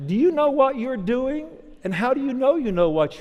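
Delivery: loud, high, laughing into the question; laughing challenge. Real, not acted. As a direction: burst out laughing and hit the audience with it. Laughs then challenges audience.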